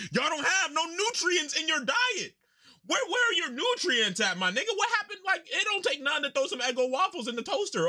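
roast them playfully; mock scolding. Real, not acted. Mock scolding about breakfast.

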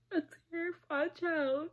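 high, tearful break in the voice; real sobbing while saying the words; crying. Real, not acted. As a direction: let the tears take the words. Tearful account of childhood abuse.

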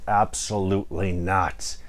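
low, slow flat refusal; a hint of a gruff ogre saying no; lightly voiced grumpy ogre; ogre refusing. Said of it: "the ogre owner said".

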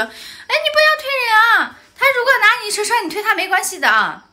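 mock the mother excusing her daughter; mimicking the other mother. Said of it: "然后她妈妈就说". (尖声模仿对方妈妈护短)

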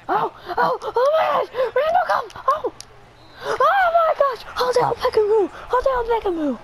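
very high, squealing celebration; real high-voiced gamer hype; thrilled outcry. Real, not acted. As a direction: cry out high, amazed by the catch. Celebrating another catch in game.

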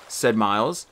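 ordinary delivery, nothing marked

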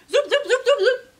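string of very high, loud zoops; rapid zoop sounds. A narrator's reading. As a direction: rattle off squeaky machine pops.

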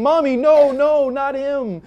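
put-on high, loud whiny child's protest; mock a cowering little kid; pretend frightened child. Preacher voices child afraid of stranger, high whiny protest. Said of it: "hiding their face and cowering and saying".

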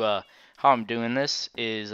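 ordinary talk

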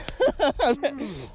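delighted high-pitched laugh; high giddy laugh. Real, not acted. Laughing about first muskie together.

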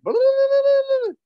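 loud, high trilled call back; answering Fijian yell. Retold or imitated. Man on the hilltop answering the old man, trilled yell. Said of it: "the man yells back".

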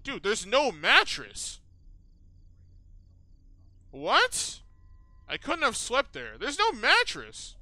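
appalled, drawn-out complaint; indignant disbelief. Real, not acted. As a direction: stretch the words out in loud disbelief. Discovers the bed has no mattress.

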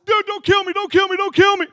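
loud, high, frantic mock begging; terrified pleading. Brother-in-law facing a gun, frantic pleading. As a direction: beg for your life in comic panic.

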